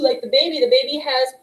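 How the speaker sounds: usual speaking voice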